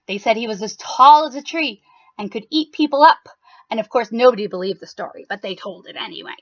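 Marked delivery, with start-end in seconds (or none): none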